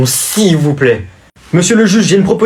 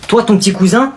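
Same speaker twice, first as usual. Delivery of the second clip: normal talking voice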